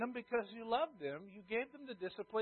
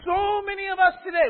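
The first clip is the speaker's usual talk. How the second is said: high, loud preacher's cry; preaching shout; 10 semitones higher, 12 dB louder than usual. Real, not acted. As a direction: lift it into a full preaching shout.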